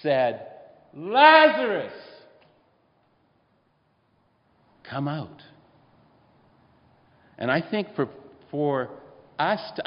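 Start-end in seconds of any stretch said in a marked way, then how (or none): 0.9-5.4 loud, high call of Lazarus; full-voiced, sky-high shout to Lazarus in the tomb; top of the lungs. Shown as a demonstration. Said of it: "at the top of his lungs".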